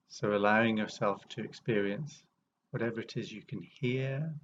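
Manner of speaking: unhurried meditation guide, long pauses between phrases; guided meditation. Real, not acted. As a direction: ease listeners into awareness, phrase by phrase, leaving space.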